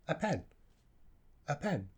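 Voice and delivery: "a" reduced to a quiet uh; weak form a. Shown as a demonstration. Teacher showing the correct weak form. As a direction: hurry through "a" as uh to pen.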